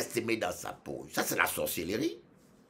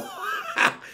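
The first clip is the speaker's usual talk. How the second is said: high-pitched burst of laughter; real short cackle. Real, not acted. Own laugh after the imitation.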